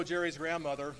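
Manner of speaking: normal talking voice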